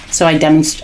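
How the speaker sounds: ordinary delivery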